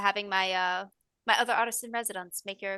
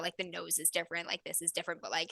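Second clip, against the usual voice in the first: ordinary talk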